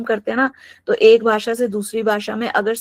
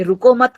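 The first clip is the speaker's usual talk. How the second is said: रुको मत as one raised, louder unit; stress on रुको मत; 4 semitones higher, 6 dB louder than usual. Shown as a demonstration. Teacher quoting the phrase before the comma, रुको मत stressed as one unit. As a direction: lift रुको मत together and stop after मत. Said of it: "के बाद comma लगा".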